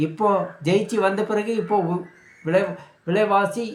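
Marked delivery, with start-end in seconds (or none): none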